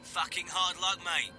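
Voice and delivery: high Cockney voice; rough Cockney sympathy; Cockney accent. Acted. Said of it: "he said in a Cockney accent".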